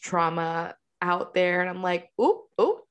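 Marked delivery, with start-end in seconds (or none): 2.2-2.8 high, startled "oop" reactions; mock alarm. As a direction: squeak it like awkward gossip. Reacting to friends' trauma stories.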